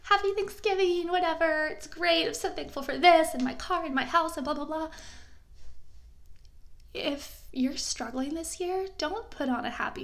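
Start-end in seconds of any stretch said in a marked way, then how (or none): 0.0-4.9 mock fake cheer. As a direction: parody someone faking holiday happiness. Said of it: "you have to fake this happiness, this".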